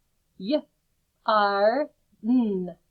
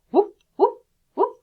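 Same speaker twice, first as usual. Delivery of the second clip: high playful woof woof; mock yapping dog; dog bark; 11 semitones higher, 10 dB louder than usual. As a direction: bark like a pretend puppy. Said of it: "Bark like a dog".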